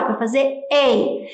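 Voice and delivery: "E" drawn out as a long vowel; long A sound. Shown as a demonstration. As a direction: stretch the "E" slowly, long A. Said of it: "é o som longo do A". ("E" dito longo e devagar)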